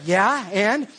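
loud, high, playful "so what"; mock nonchalant. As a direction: act cool and unbothered, hammed-up. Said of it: "And I was like".

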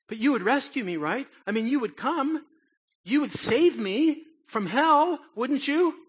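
put-on high, loud pleading child's voice; insistent little kid. Child pressing his mother about hell, high and pleading. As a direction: keep pushing like a needy child.

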